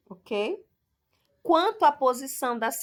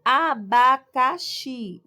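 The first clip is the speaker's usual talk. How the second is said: slow, louder "Abacaxi" in four separate beats; sílaba por sílaba; 6 dB louder, much slower than usual. Shown as a demonstration. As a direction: count out A-ba-ca-xi with the voice. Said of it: "Você tem aí quatro sílabas". ("Abacaxi" devagar e forte, sílaba por sílaba)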